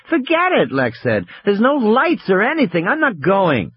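put-on high, stubborn little girl; stubborn child voice. A narrator's reading. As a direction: refuse like a scared child. Lex refuses, child voice.

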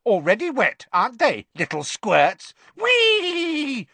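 high, loud mischievous poltergeist; gleeful whooping taunt; Peeves taunting. Acted. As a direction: poltergeist jeering at soaked students.

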